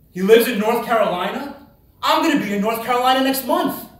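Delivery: thrilled realization; excited disbelief. Retold or imitated. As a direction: gasp it out like you just found treasure. Excited discovery of his address.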